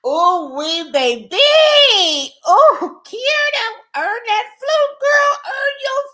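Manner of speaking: high, loud hype cheer; squealing hype for Kierna; cheering shout. Real, not acted. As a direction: cheer her on full voice. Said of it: "cheering Kierna on".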